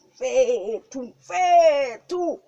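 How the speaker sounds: loud, high, drawn-out exclamation; excited calling out. Acted. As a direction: stretch it out into a rising, high shout. Excited outburst about his look.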